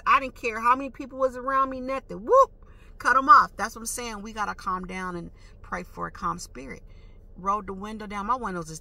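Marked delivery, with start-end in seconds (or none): 2.2-3.5 loud, high whoop and punchline; imitated swerve. Driver re-enacting cutting off the other car, loud swooping imitation. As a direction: act out the sudden swerve, loudly. Acts out swerve a second time.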